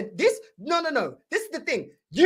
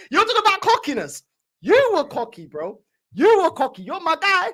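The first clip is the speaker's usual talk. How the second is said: ordinary delivery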